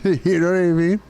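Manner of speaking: asks through a real laugh. Real, not acted. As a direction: laugh it out as you ask. Laughing through his rant.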